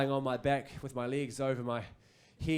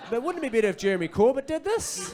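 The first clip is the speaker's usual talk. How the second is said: loud, high, hammed-up blurt; blurted suggestion; 8 semitones higher, 7 dB louder than usual. Host blurting to a meeting of executives, hammed-up and loud. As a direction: pitch it high and loud to the room. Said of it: "heat of the moment, I just was like".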